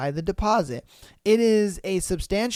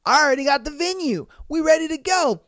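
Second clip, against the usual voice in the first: excited; 6 semitones higher, 3 dB louder than usual. Retold or imitated. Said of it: "he's just like".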